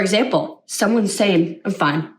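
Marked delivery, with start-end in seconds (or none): 1.6-2.1 flat and down. Shown as a demonstration. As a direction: mumble "I'm fine." low and dejected. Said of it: "Well, that doesn't sound fine".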